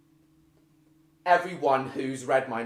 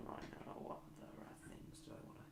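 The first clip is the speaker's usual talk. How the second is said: much softer whisper to himself; whispered aside; 26 dB quieter, faster than usual. Real, not acted. Streamer thinking aloud to himself, whispered mutter. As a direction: murmur while thinking.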